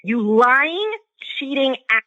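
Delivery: normal talking voice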